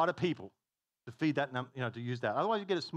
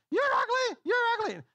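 very high, loud parrot screech; squawking parrot; 9 dB louder than usual. Acted. Parrot insulting a customer in the hardware store, squawking. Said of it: "the parrot looks and goes".

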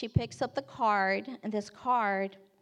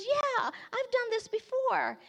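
put-on high, drawn-out 'yeah' of a keen girl; eager teen; 12 semitones higher than usual. Girl assures the storyteller she's done this, eagerly. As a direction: imitate a girl proudly in the know, pitched high. Girl confirms she knows it.

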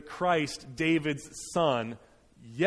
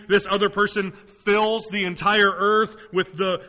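louder, higher sermon build; impassioned preaching; 4 semitones higher, 5 dB louder than usual. Real, not acted. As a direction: proclaim the victory in driving, emphatic phrases. Preaches the psalm with rising force.

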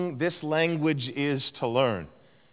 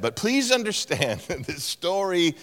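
ordinary talk, nothing marked

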